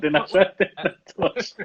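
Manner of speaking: laughing through the words. Real, not acted. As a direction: tell it while laughing. Laughs while telling the story.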